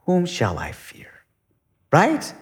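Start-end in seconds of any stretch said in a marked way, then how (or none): 0.1-1.1 soft unvoiced whisper, falling. Shown as a demonstration. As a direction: hush it like a private question. Quoting psalm, then repeated loudly.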